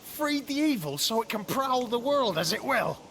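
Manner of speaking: high, loud disbelieving cry; loud shocked disbelief; shocked outcry. Acted. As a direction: shout it out in horror at what he did. Shocked at freeing evil spirit.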